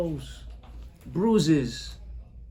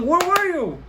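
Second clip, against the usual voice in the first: high, louder demand; 10 semitones higher, 4 dB louder, slower than usual. Acted. Father shouting at his son, demanding. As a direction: press him for the truth.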